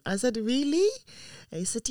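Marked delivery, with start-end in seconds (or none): 0.4-0.9 high, rising disbelief; surprised question. Retold or imitated. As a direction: react to your child's unexpected request. Mother surprised by second son's request.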